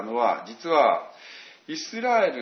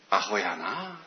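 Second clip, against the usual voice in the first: Osaka dialect; 6 semitones lower, 3 dB quieter, slower than usual. Retold or imitated. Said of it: "大阪弁で言うと".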